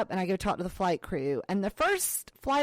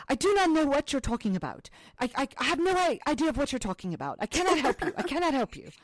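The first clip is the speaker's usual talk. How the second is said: fast, higher-pitched mock Dutch accent; bad Dutch accent; 5 semitones higher, faster than usual. Sister imitating Dutch flight attendant's refusal, in a weak Dutch accent. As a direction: rattle it off in a badly done Dutch accent. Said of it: "Amsterdam accent ... I'm terrible with accents".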